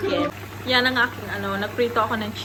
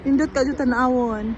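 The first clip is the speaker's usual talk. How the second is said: excited; 3 semitones higher, 6 dB louder than usual. Real, not acted. Marvelling at the river view.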